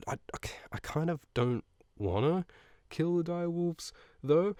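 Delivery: reluctant. Retold or imitated. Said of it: "And Robb's like".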